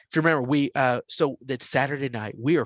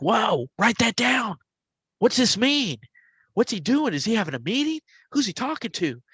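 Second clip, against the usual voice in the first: higher, excited questions, tumbling out; 9 semitones higher than usual. Retold or imitated. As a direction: marvel at a strange discovery, voice up high. Retelling excited friends.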